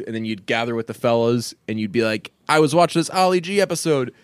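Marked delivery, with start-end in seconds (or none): 2.5-4.1 high-pitched, eager episode retelling; word-for-word recapping. Retold or imitated. Recapping an Ali G episode to the fellas, mock-eager retelling. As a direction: imitate a fan recapping, pitched well up. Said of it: "basically just recap word for word".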